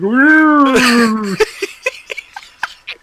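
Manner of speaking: a high wheezing laugh fit; high crying laughter. Real, not acted. Friend trying to explain the accent, high crying laughter. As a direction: laugh so hard it cracks into a squeal.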